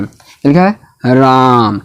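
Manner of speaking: राम with its आ slowly drawn out; stretched राम. Shown as a demonstration. Teacher demonstrating long vowel in राम. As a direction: hold the आ in राम. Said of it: "इसका example दे सकते हैं".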